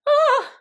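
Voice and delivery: high, loud mock shriek; shrill shriek. Retelling the filthy bath, a loud mock shriek. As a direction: let out a short squeal.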